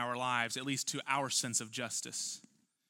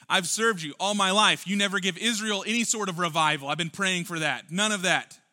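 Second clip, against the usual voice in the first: Jonah complaining; 4 semitones higher, 8 dB louder than usual. Retold or imitated. Jonah protesting to God, loud and resentful. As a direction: voice Jonah's grievance at full volume. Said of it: "Maybe Jonah might be saying".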